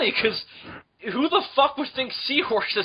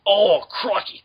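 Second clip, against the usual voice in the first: mock Australian; 6 dB louder, slower than usual. Mock Aussie again.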